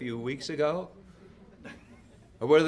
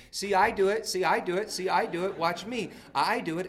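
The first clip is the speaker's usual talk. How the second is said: put-on higher-pitched child's plea to be watched; little girl seeking attention; 6 semitones higher than usual. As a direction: imitate a toddler showing off. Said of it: "she'd look at this lady and she'd say".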